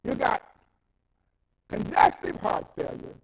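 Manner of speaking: loud, rising sermon shout; preaching at full force. Acted. Preacher scolds a dying friend's vanity, loud full-force preaching. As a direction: hammer the point home from the pulpit.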